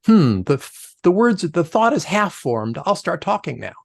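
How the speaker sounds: high, slowed musing with a laugh; self-mocking blurter. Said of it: "I'm the, like".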